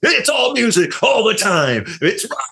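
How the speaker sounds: high, loud hyped-up rock DJ; radio DJ impression. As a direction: shout it like a rock station jingle. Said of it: "The thing that you did, Crook".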